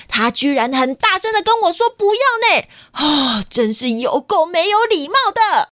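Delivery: put-on high, scolding old bird; lightly acted granny scolding the parents; indignant grandma. Grandma scolds the parents over manners, mildly indignant. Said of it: "奶奶气到脸都红了耶".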